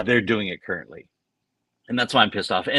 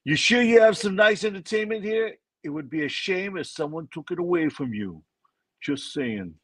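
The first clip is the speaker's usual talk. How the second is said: higher-pitched mock mob boss threat; mafia voice; 6 semitones higher than usual. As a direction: lean in like a mob boss shaking someone down. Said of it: "You gotta read this in a mafia voice".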